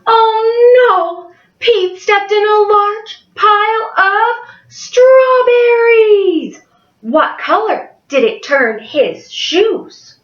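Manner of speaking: bright storytime voice for a class; cheerful picture book reading; reading to children. Acted. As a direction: read it like a kindergarten teacher.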